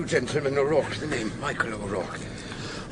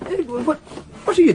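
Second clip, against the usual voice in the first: surprised; 5 dB louder than usual. Acted. Finds Flay packing up his props.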